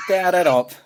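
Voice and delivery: a son cutting off his dad's boast about his legs, mock exasperated; mock exasperated outburst. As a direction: blurt it out at dad, exasperated. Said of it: "I was like".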